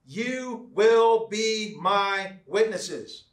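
very slow, word by word; deliberate, emphatic quote. Acted. Preacher answers with Jesus' last words, slow and deliberate. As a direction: drive the verse home one word at a time.

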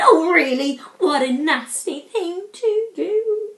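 loud, high, hurt dragon protest; an injured dragon complaining; offended dragon. Acted. Dragon scolding the attacking Vikings, loud and hurt.

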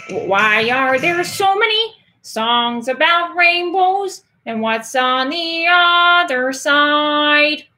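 high, slow Kermit voice; Kermit the Frog voice. Acted. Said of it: "you want my best Kermit the Frog impression?".

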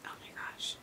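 breathless whisper; shocked whisper. Real, not acted. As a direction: gasp it out unvoiced.

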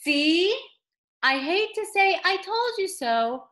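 ordinary delivery

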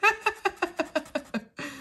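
high, airy giggle. Real, not acted. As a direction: laugh at your own silly joke. Laughs at own duck joke.

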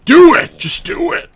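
loud, high emphatic yell; loud shout. Real, not acted. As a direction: shout it to urge them on. Urging viewers to play.

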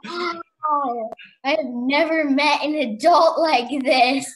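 loud, emphatic astonishment; hammed-up wonder; retold amazement. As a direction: gasp it out, playfully awestruck. Retold amazement at a playful adult.

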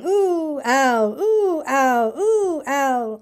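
seesaw between yelps as if bounced up and down; bouncing ooh-ow. A narrator's reading. Piglet bounced all the way home, seesawing ooh-ow. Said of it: "And he was saying".

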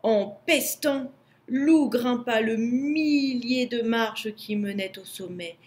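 plain reading voice

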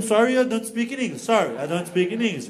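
stock apology in heavy Brazilian English; Brazilian-accented English. Retold or imitated. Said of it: "Eu já meto um".

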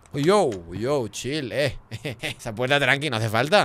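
ordinary talk, nothing marked

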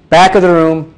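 projected, carrying tone; full presenter voice; back-of-the-room voice. Shown as a demonstration. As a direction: send it to the back row.

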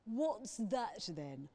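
slow and solemn, like a dragon; Sandra the dragon. A narrator's reading.

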